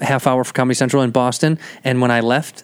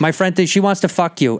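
imitated Boston girls, brazen; mock Boston-girl voice; brash Boston accent; 4 semitones higher than usual. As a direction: replay it as a forward Boston girl. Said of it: "just brashness to it".

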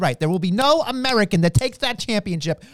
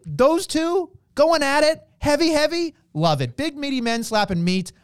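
ordinary delivery

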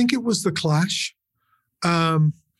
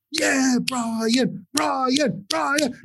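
high-pitched imitation of fans shouting; shouted cheer, held back in volume; mock crowd cheer; 7 semitones higher, much slower than usual. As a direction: chant it like a roaring audience. Imitates taped crowd cheering band.

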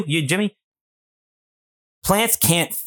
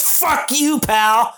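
higher, put-on voice for the plant; voicing the thorny plant; 9 semitones higher, much slower than usual. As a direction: give the plant a snide little voice. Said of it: "he says".

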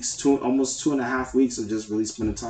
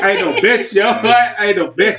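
laughing denial; 9 semitones higher, 8 dB louder than usual. Real, not acted. Denying crying, laughing.

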